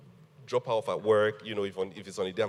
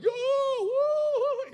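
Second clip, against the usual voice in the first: imitating a crowd's whooping cheer, much higher and louder; crowd hollering; 6 dB louder, much slower than usual. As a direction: whoop it up like a rowdy audience. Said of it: "everybody's like".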